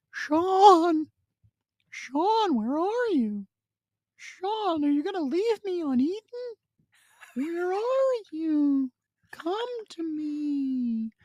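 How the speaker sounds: very high, slow pleading burrito voice; the leftover burrito begging from the fridge to be eaten; talking burrito voice. Acted. Burrito in the fridge calls Sean to eat it, very high pleading voice. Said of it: "it was like".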